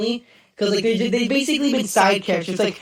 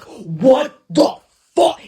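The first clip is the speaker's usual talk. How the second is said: louder stunned outburst; shocked reaction; 6 semitones higher, 9 dB louder, much slower than usual. Retold or imitated. Viewer reacting to the season ending, loud stunned outburst. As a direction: blurt it like you just saw the cliffhanger. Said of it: "I remember being like".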